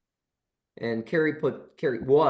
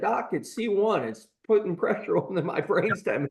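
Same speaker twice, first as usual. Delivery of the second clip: hammed-up wounded patient, much higher; mock weeping; 7 semitones higher than usual. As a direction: whimper it pathetically while laughing through it. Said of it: "kind of weeping and sobbing".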